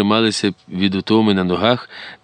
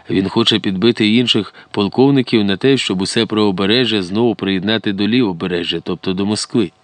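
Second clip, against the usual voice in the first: ordinary delivery, nothing marked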